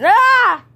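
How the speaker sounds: loud high-pitched scream of a toy burning. Acted. Toy being pushed into the fire, screaming. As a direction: shriek as if thrown in the fire.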